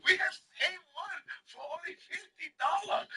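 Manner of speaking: high-pitched street seller impression; Chinese vendor. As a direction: mimic the vendor, pitched well up. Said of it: "Guy goes".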